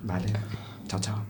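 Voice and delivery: hushed quick chao chao; whispered goodbye. Acted.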